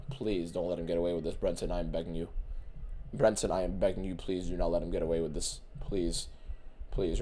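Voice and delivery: quiet pleading. Real, not acted. Streamer begging the referee quietly, low and soft. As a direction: beg under your breath.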